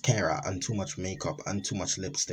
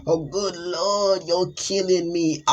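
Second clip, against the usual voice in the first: put-on gloating monster girl; 7 dB louder than usual. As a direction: savor every word like a killer. Monster girlfriend gloating over kill.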